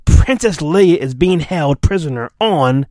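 ordinary talk

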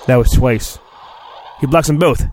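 ordinary talk

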